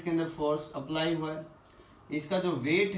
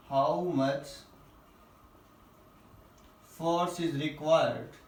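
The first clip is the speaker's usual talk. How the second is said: phrase by phrase with long pauses; slow dictation; 2 semitones lower, much slower than usual. Real, not acted. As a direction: dictate it in short chunks, waiting after each.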